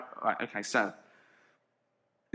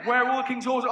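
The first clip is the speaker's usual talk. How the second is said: indignant demand; 8 semitones higher, 3 dB louder, faster than usual. Acted. King demands to know.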